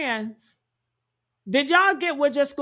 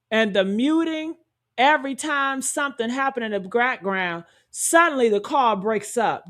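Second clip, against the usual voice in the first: ordinary talk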